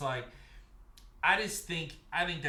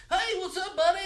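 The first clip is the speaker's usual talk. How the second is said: high-pitched mock-friendly greeting; chummy nice guy; 14 semitones higher, 5 dB louder than usual. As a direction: put on a much higher, overly chummy voice. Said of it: "you don't want M J F to be like".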